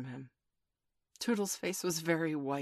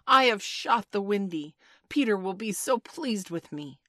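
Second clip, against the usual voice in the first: loud, higher, triumphant boast; proud cry; 6 semitones higher, 7 dB louder than usual. A narrator's reading. Toodles boasting over his kill to the boys, proudly. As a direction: announce the deed with ringing pride. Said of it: "he cried proudly".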